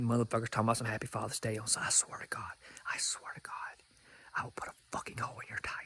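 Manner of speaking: fast, breathy threat whispered close; whispered warning to deadbeat dads; menacing whisper. Acted. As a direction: hiss the threat like a secret promise.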